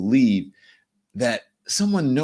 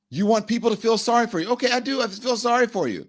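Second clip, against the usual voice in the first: ordinary delivery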